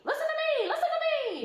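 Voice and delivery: high, loud goose shriek; screaming goose. A narrator's reading. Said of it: "screamed the goose".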